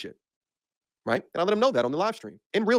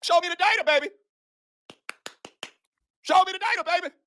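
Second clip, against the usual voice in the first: higher sing-song taunt; taunting demand; 8 semitones higher than usual. Real, not acted. Mocking the defender, a higher sing-song demand for proof. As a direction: tease him for the data.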